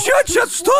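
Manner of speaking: high, startled what-what-what; shocked disbelief. Real, not acted. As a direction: squeak out your disbelief. Shocked by poop stories.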